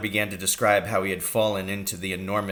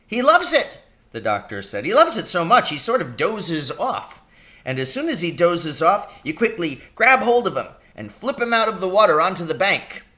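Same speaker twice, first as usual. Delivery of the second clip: higher, louder, kindly fussing doctor; a brisk country doctor fussing over his patient; little old doctor voice; 10 semitones higher, 6 dB louder than usual. A narrator's reading. Doctor asking his injured patient about the pain.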